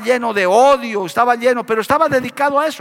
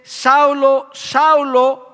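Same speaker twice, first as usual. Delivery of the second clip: a raised, calling voice from above; voice from heaven; 4 semitones higher, much slower than usual. A narrator's reading. Heavenly voice calls to Saulo on the road, lightly raised. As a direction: call out the name as a heavenly voice. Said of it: "oye una voz que le dice".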